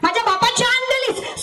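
high, outraged exclamation; indignant retort. Acted. Husband answers his wife's oath, indignant. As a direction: throw the oath back at her.

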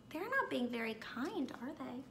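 plain reading voice

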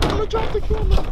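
high, fast, gasping in wind; high panicked gasp. Real, not acted. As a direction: blurt it out while freezing. Struggling in the wind.

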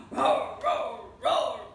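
loud, high hammed-up roar; lion roar. Foolish lion roaring at the animals, loud playful roar.

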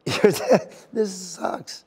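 embarrassed by one's own tears; tearful. Real, not acted. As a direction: laugh helplessly while really crying. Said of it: "I'm starting to cry now".

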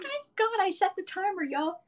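high, bright burst of relief; relieved exclaim. Real, not acted. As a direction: blurt it out, thrilled the timer is set. Relief timer was set.

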